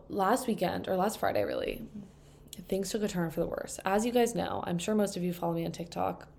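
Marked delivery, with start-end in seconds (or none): none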